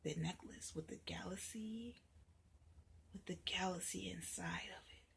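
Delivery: hushed, awed description; whisper. A narrator's reading. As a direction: whisper about the magic necklace.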